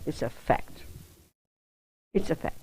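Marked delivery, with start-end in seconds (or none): 0.0-0.8 barely voiced, dropping the line almost to a whisper; hushed aside. Shown as a demonstration. Teacher murmuring the fact under her breath to students, hushed. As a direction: let it fall away, breathy and soft. Repeating the point for emphasis.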